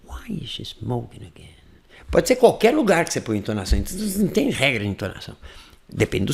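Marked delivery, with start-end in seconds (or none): 0.0-1.6 very soft, low voice; intimate voice. Shown as a demonstration. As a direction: murmur it to yourself. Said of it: "Ainda com voz íntima". (voz íntima, grave e baixinha)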